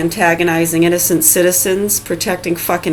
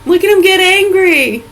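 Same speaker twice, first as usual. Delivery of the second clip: squeaky, much higher mock voice with a giggle; 11 semitones higher than usual. Teasing critics who blame him, in a squeaky mocking voice. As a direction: lampoon them in a silly high squeak.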